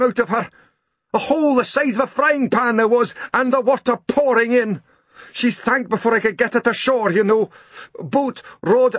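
high, breathless Scots voice, pouring out the story; dripping postman tells Grumble his boat sank, high and upset; shaken Scottish postman. Acted. Postman telling of his sunk boat, pitched high with alarm. Posty's shocked tale of sinking.

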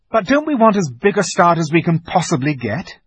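high, loud questioning; urgent appeal. A narrator's reading. Peter argues for a head start, urgent. Peter urges leaving early.

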